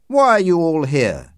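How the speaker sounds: irritated. A narrator's reading. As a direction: demand an explanation from the whole group.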